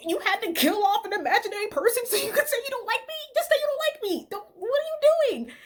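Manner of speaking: indignant high-pitched rant; outraged disbelief. Retold or imitated. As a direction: throw the question at him in disbelief. Confronting liar in retold outburst.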